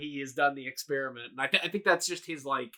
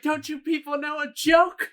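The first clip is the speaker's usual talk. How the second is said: high, louder panicked plea; mock screaming; 12 semitones higher, 5 dB louder than usual. Retelling the locked-door scene, mock screaming. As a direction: imitate someone yelling to be let out. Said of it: "He's, like, screaming".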